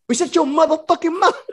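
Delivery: sudden high mock pimp order; jokey put-on pimp command; Iceberg Slim. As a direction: snap back into pimp bossiness, half laughing. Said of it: "I was Iceberg Slim in that shit".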